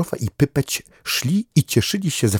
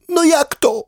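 very high, startled duck voice; a duck jumping up in disbelief; indignant duck; 4 dB louder than usual. Acted. Duck to dog, startled disbelief in a very high voice.

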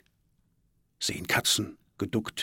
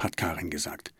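ordinary delivery, nothing marked

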